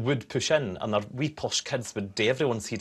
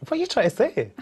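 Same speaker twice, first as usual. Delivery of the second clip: mock offended; 10 semitones higher, 3 dB louder than usual. Real, not acted.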